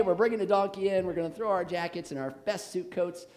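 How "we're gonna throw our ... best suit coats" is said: ordinary talk, nothing marked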